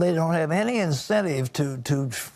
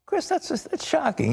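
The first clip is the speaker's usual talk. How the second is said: shocked; 13 semitones higher than usual. Real, not acted. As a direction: voice jumps high in real disbelief. Host reacts to shocking statistic.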